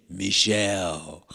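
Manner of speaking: low, drawn-out mock-solemn call; dramatic silly call. Priest pranking her from behind, dramatic and silly. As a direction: stretch the name out slowly and low. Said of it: "I was being dramatic".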